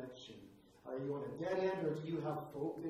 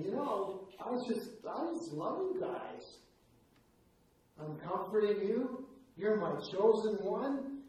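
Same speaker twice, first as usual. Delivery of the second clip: higher and slower doting voice; God speaking tenderly to his chosen ones, higher and slow; sweet, tender; 5 semitones higher, 6 dB louder than usual. Retold or imitated. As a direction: sweetly reassure your own people. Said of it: "God's getting sweet on his people".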